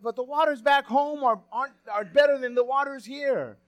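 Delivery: high, loud boastful protest about home waters; mock-proud insistence; hammed-up patriotic boast; nationalistic pride. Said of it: "he got a little nationalistic there".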